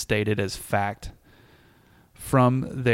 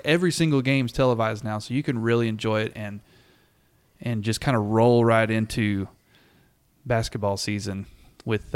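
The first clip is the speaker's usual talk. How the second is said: normal talking voice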